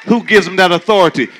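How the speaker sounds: loud rising preacher call and answer; preaching at full force. Acted. Preacher to congregation, loud call and response.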